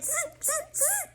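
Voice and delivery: high, soft buzzing chatter; imitated monkey talk. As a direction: buzz like a storybook monkey. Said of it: "stamped both of their feet back at him and said".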